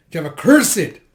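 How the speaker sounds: loud, high-pitched furious cry; acted scream. Man wailing on Jeha, screaming in anger. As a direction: burst out cursing at the top of the line. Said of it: "He's just screaming".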